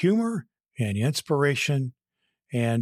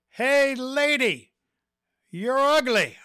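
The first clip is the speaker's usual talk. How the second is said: much higher, drawn-out parrot voice, louder; squawky parrot; 11 semitones higher, 4 dB louder, slower than usual. Acted. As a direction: play a rude talking parrot. Joke, parrot taunts woman.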